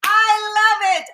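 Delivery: loud, high, drawn-out delight; delighted outburst. Real, not acted. Host reacting to the poem's line, real delighted outburst. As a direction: burst out loving it, stretched and emphatic.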